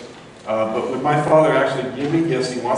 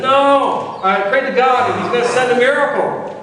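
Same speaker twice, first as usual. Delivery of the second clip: much higher, loud defiant refusal; stubborn yell; 10 semitones higher, 5 dB louder than usual. Retold or imitated. Man on the rooftop yelling up at the helicopter, high loud refusal. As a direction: hold out for the miracle at full voice. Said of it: "And he says".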